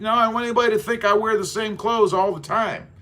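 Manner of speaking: ordinary talk, nothing marked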